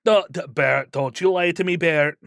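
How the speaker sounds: a hint of a Dwarven Scottish brogue, scolding a son; disappointed father in a Scottish accent, pleading and chiding; disappointed Scottish dad. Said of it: "like a disappointed father to his son".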